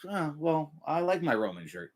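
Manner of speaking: soft, deflated reply; acted hurt. Shirt wearer answering a hater, soft and deflated. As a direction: play someone quietly crestfallen.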